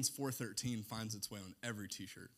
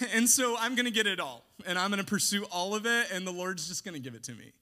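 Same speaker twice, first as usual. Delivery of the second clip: mildly louder, fast mock boast; sarcastic mimicry; 6 semitones higher, 9 dB louder, faster than usual. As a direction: rattle off the greed mockingly. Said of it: "I know I'm being sarcastic".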